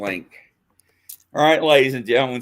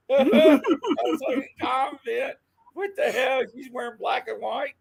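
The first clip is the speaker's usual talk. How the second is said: high, squeaky voice from laughing; real laughter pushing the voice way up; laughing retell; 9 semitones higher than usual. Real, not acted. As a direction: squeeze the words out through giggles. Still cracking up at the gaffe.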